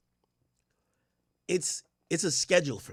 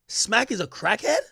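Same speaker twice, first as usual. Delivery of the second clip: ordinary talk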